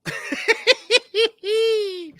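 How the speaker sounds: a big high burst of laughter; loud laugh. Real, not acted. Host bursting into a loud laugh at the caller.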